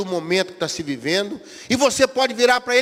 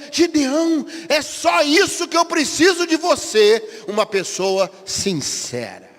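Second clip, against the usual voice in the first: voice of God; 6 semitones higher, slower than usual. Acted. God answers Gideon.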